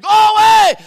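very loud, high-pitched yell; shouted dismissal. Acted. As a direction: holler it to send a pest packing. Acts out sleeper refusing the neighbour.

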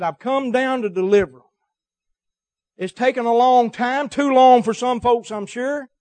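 loud, high, driving preacher voice; preaching at full force. Acted. Preacher stirring his congregation with God's coming, full-force preaching. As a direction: build it like a revival peak.

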